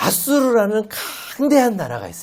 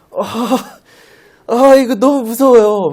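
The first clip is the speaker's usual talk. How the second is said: mock scared; 5 semitones higher, 8 dB louder than usual. Pastor voices himself scared between giants.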